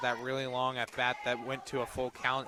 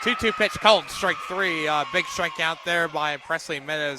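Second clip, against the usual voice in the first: excited strikeout call; 2 semitones higher, 8 dB louder than usual. Real, not acted.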